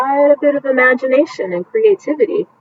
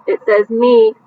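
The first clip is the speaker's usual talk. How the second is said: ordinary delivery, nothing marked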